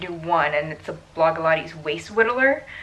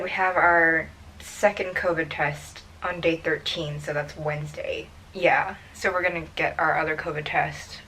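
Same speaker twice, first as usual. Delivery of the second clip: ordinary talk, nothing marked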